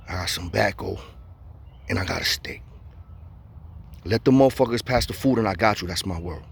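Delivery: low and soft, a quiet deal; hushed offer. Retold or imitated. Offering the neighbor a deal through the vent, quietly. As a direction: keep it down so the guards don't hear.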